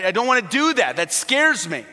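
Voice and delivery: high, loud mock panic; acted kid's fear. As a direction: imitate a scared child balking. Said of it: "there's physical terror".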